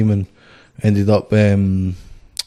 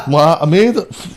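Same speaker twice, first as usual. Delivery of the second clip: higher, giggly mock protest; laughing retort; 6 semitones higher, slower than usual. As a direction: protest through laughter, pleased with yourself. Repeats reply, laughing.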